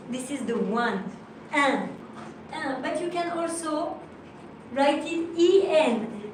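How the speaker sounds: usual speaking voice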